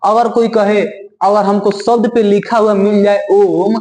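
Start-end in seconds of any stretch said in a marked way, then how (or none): 3.3-3.7 "ओम" with a lengthened O; drawn-out vowel. Shown as a demonstration. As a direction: stretch the O of "ओम". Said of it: "इसको लंबा समय तक बोलना है".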